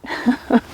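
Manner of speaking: quick amused laugh; short laugh. Real, not acted. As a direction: break into a little laugh. Laughs at own joke.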